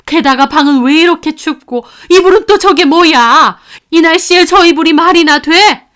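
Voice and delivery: loud, high, furious mother scolding her son; a grandmother's indignant tirade, pitched high; angry scolding. Acted. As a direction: tear into him with outrage. Mother confronts son over granddaughter.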